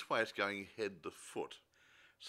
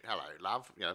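a light Cockney "hello love", like a London cabbie; tossing off a breezy London cabbie greeting in Cockney; Cockney accent; 3 dB louder than usual. Retold or imitated. Co-host mimicking the doctor's new Cockney voice, light Cockney. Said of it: "He gets a nice British accent".

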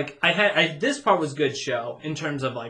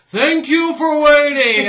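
high, loud, slow bellow; shouted spooky welcome; top of my lungs; 11 semitones higher, 10 dB louder, slower than usual. Retold or imitated. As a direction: yell it right in the guest's face. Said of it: "at the top of my lungs".

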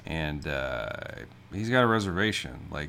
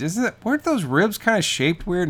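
high, louder imitation of her asking; hesitant woman's question; 12 semitones higher, 6 dB louder than usual. Woman asking about the odd ribs, lightly imitated in high pitch. Acting out her line from the movie.

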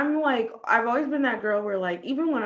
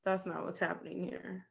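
dismissive brush-off; 2 semitones lower, 9 dB quieter than usual. Pretend. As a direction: wave it off quietly. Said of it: "I'd be like".

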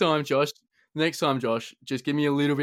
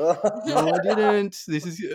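laughing protest; 7 semitones higher, 4 dB louder, slower than usual. Real, not acted. Laughing while retelling.

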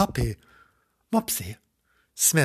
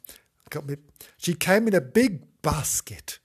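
ordinary delivery

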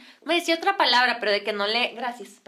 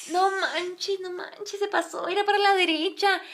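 mock dismay; 7 semitones higher, slower than usual. Acted dismay at missed exit.

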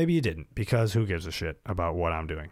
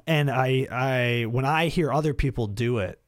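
ordinary delivery

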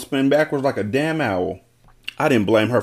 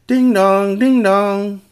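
high, loud sing-song ding dong; doorbell imitation; 9 semitones higher, 5 dB louder, much slower than usual. A narrator's reading. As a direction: chime it like a doorbell. Narrator voices the doorbell.

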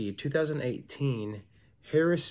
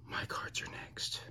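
whispered; 13 dB quieter than usual. Real, not acted. Nervous about his own cards.

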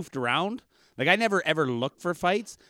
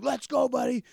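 high-pitched imitation of an angry guy; retold tough-guy challenge; mock mad challenge; 9 semitones higher than usual. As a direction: goad him into a fight, pitched up. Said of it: "I can see why he's mad".

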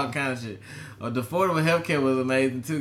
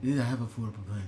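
quiet, mouth pulled into a Trump shape; lightly voiced Trump on request; Trump impression; 4 semitones lower, 6 dB quieter, faster than usual. Friend asks for Trump again, lightly mimicked. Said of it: "Do Trump again?".

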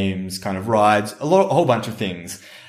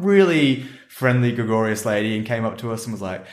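ordinary talk

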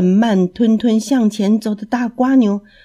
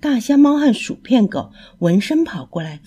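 ordinary delivery

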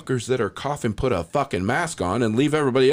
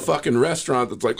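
ordinary talk, nothing marked

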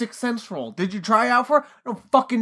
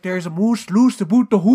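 put-on Minnesotan accent; 3 dB louder than usual. Imagining Arnold walking around as a Minnesotan, joke accent. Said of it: "like, with, like, a Minnesotan".

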